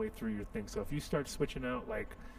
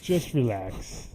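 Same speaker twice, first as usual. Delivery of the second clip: slow, laughing louder reassurance; 8 dB louder, much slower than usual. Shown as a demonstration. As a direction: draw it out through a laugh. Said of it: "repeated with a laugh".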